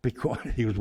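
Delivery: ordinary talk, nothing marked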